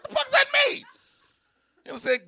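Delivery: high, squeaky disbelief; incredulous squeal. Retold or imitated. Reacting to friends calling him glizzy gobbler, high incredulous. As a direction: squeak it out in shock.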